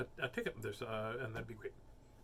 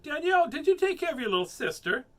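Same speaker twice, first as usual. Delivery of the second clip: loud, high nagging mom; mother scolding Danielle, louder and higher; bossy mother; 11 semitones higher, 7 dB louder than usual. Acted. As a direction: scold her into moving. Mother checking on child.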